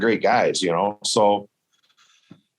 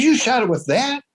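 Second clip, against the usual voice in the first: much higher, incredulous question; retold disbelief. Hunter can't believe a bow did it, incredulous. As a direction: imitate him gaping at the bow.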